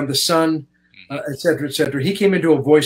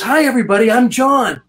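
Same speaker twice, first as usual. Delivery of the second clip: a lightly pitched-up imitation of a cheerful star greeting the room; friendly John Lithgow; 10 semitones higher, 6 dB louder than usual. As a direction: mimic a warm, bubbly celebrity saying hi.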